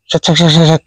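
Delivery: rapid run of hissy "sek" sounds; imitated wingbeat noise. Shown as a demonstration. Explaining the noise a descending bird makes, imitated wingbeats. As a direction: mimic a bird's beating wings, sek-sek-sek. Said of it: "gitu ya maksudnya ya".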